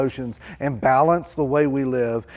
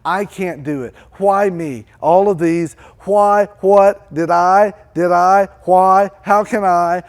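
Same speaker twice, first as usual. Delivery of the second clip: rising, loud, scattered pleading; panicked protest; 4 semitones higher, 6 dB louder than usual. Acted. Moses realising he must provide everything, loud and flustered. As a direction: stumble over the words in panic. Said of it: "he sees it and says".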